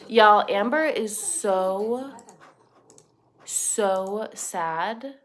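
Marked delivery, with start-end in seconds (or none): none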